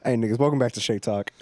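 loud, punchy host opener; mock show intro. Host opening the podcast, loud hype intro. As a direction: greet the viewers like a show host.

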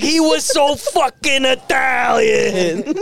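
high, slow melodramatic wail; mock grief. Mobster mourning his fallen partner, hammed-up wail.

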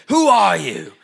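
a hint of the Taken threat; lightly imitated Irish-tinged Liam Neeson. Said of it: "a Liam Neeson".

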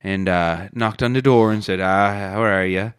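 put-on storytelling in a broad Irish accent, rolling along. Irish bar owner telling his funeral story in an Irish accent. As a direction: spin the yarn like an Irish pub teller.

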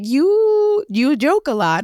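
high, lightly voiced therapist observation; mock therapist. Therapist telling client she jokes a lot, lightly voiced. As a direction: point it out like a knowing therapist.

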